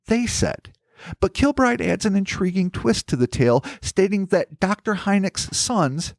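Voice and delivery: formal documentary-style read; scripted narration. Acted. Host reading Hynek's disputed account to his co-host, scripted narration. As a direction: narrate deliberately, leaning on the key words.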